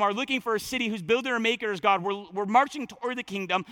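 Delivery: ordinary talk, nothing marked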